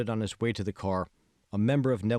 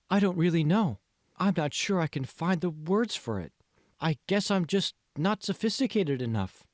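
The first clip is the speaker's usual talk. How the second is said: lightly voiced young woman, higher; hesitant, a touch self-conscious; Megan unsure; 8 semitones higher than usual. A narrator's reading.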